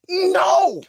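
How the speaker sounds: high, drawn-out mock wail; dismayed. Caller losing his replacement announcer, dismayed mock wail. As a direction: cry out in comic despair. Retold dismay at being turned down.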